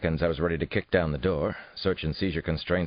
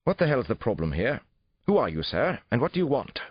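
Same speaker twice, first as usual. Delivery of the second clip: higher-pitched, clipped English challenge; clipped English accent; 8 semitones higher, 3 dB louder than usual. A narrator's reading. Suspect confronts police at the door, clipped English accent. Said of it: "he asked in a clipped English accent".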